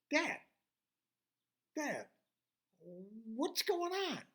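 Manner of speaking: put-on high-pitched child calling for Dad; high, puzzled daughter's plea; bewildered calling. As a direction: call out in confusion, drawing out the question. Bewildered girl calls to her father.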